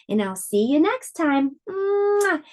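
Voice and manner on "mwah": blown kiss. Real, not acted. Blows a kiss to viewers.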